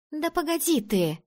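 high-pitched, hurried "wait"; impatient brush-off. A narrator's reading. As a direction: put someone off mid-search. Fending off friend while searching drawers. (отмахиваясь, высоким голосом)

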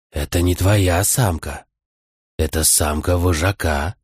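slow, low wolf voice; drawn-out, low animal warning; whispering wolf. A narrator's reading. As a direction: warn slowly, stretching the words like a wolf. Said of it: "прошептал ночной волк".

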